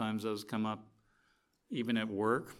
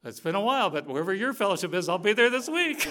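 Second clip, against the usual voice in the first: eager promise; 8 semitones higher, 9 dB louder than usual. Retold or imitated. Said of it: "The guy says".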